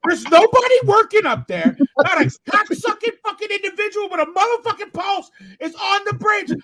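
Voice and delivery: roaring high and loud at the empty roadworks; furious shout. Real, not acted. Friend rants to the crew about the closed exit, furious shout.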